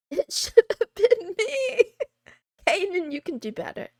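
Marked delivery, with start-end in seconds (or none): 0.1-1.8 laughing, high and loud; laughing mock lament; mock jealous laugh. Joking jealous cry, laughing.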